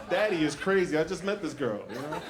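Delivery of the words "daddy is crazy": ordinary talk, nothing marked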